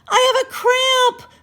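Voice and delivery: crying out in pain. A narrator's reading. Andrea crying in water.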